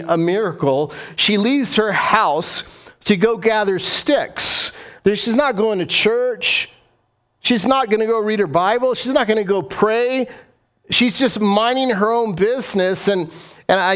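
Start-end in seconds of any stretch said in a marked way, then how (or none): 1.2-13.1 animated preaching. Acted. Sermon storytelling, raised.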